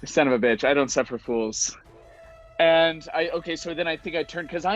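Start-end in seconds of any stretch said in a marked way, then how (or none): none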